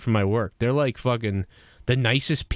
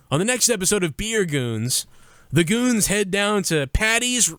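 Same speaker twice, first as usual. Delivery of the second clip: mock promo announcer; 6 semitones higher than usual.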